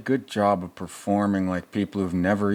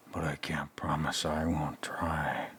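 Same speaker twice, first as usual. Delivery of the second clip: soft, low whisper; mock sinister whisper to his partner; creepy whisper; 4 semitones lower, 8 dB quieter than usual. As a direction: whisper it like a sly threat. Joking creepy line for a character.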